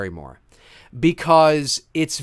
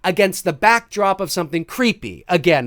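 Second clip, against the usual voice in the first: ordinary delivery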